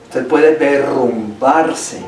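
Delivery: normal talking voice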